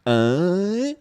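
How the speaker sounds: put-on high, slow, slurring Scottish drunk; drunk guy voice. Mimicking a drunk describing pickled shrimp. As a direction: ham up the jakey's weird craving. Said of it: "And he was like".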